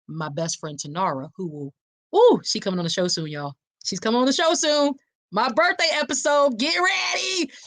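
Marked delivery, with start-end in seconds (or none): 2.1-7.5 giddy high-pitched hype announcement; excited plug. Real, not acted. Host teasing her birthday show to listeners, excited.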